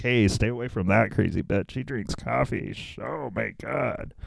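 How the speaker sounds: softly confided mock warning; hushed warning. Adolf warning another sinner about Mary, hushed. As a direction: warn a fellow inmate under your breath, mock-appalled.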